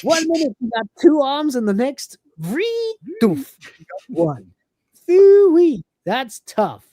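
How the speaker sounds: blithely callous, high and sing-song; Cat impression. Cat consoling one-armed Lister, high and breezy. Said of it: "he says".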